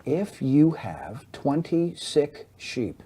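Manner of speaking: slow, low-volume demonstration with clear gaps. Shown as a demonstration. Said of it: "let me say it slower, and I'm gonna lower my voice".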